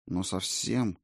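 usual speaking voice

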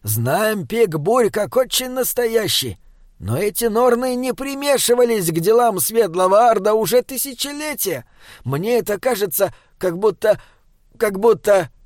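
high-pitched troll voice, animated; troll character, earnest. Acted. Troll warns his companions about the Norns, earnest and high. Troll's speech in narrated story.

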